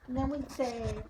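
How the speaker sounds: ordinary delivery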